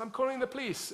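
high-pitched frantic threat; agitated landlady. Retold or imitated. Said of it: "She said".

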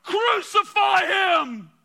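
high, slow roaring cry of the mob; a mob's shout for execution; crowd shouting. Acted. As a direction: bellow it out like a furious crowd. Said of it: "they shouted all the more".

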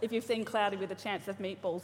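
normal talking voice

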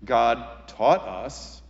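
normal talking voice

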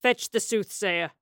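slow, weighty command; officer's order. A narrator's reading. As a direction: give the order with authority. Senior officer orders a soldier.

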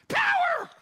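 shrieking high strangled Power; Kramer impression. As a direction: yelp like electrocuted. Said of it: "Like Kramer from Seinfeld".

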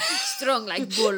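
slow, comic Russian strongman line; Russian accent. Pretend. Co-host joins the Russian joke, slow Russian accent. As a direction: draw it out in mock Russian. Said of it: "I don't think that's a Russian accent".

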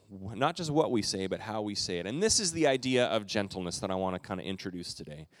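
ordinary talk, nothing marked

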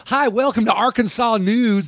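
high, slow hammed-up Southern newscaster drawl; mock Southern anchor. Joking that Southern news anchors sound folksy: mock Southern news anchor. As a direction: play a Southern local news anchor, lightly mocking. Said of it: "the people in the South aren't like".

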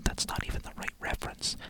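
hushed, nitpicking whisper; very soft, voiceless grumble; whispered aside. Acted. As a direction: whisper the correction to yourself. Aside on the letter.

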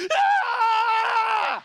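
high, loud sustained scream; re-enacted frantic shriek; long piercing scream. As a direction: scream at full blast without stopping. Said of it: "all he heard on the phone was".

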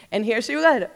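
ordinary talk

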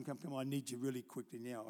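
ordinary delivery

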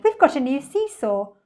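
put-on high, loud child's cry; excited shout. A narrator's reading. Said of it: "shouting with excitement".

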